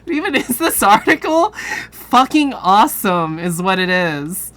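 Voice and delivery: excited. Real, not acted. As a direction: blurt it out in delight. Delighted reaction to article.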